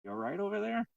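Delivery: wife checking on her husband, high and concerned. Retold or imitated. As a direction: call over with sudden concern. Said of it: "She goes".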